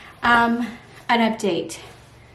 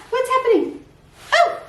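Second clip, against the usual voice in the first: surprised; 14 semitones higher than usual. Real, not acted. Sudden puzzling noise in kitchen.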